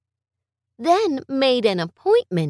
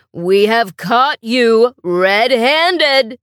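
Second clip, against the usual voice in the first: slow, louder, stern principal; stern accusation; 6 dB louder, slower than usual. Acted. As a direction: lay the charge down slowly.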